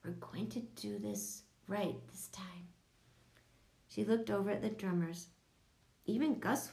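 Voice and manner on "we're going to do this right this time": breathy stage whisper, no voice. A narrator's reading. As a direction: whisper it so only the class hears. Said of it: "She made her voice a whisper".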